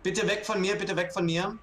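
high urgent begging; frightened pleading. Real, not acted. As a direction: plead for the killer to leave. Begging killer to leave.

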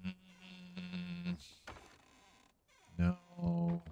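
high soft voice. Acted. Searching for ingots.

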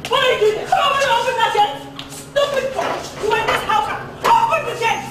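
high-pitched, furious ordering; high, indignant scolding; angry calling out. Acted. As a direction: call out irate commands at a high pitch. Orders gate opened, hurling insults.

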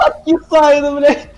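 loud alarmed yell; shouting. Real, not acted. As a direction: shout in the heat of play. Player yelling during chase.

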